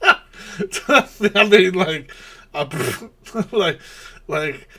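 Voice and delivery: bursting into high laughter; laughing through the words. Real, not acted. Vlogger cracking up at a boring clip, laughing hard.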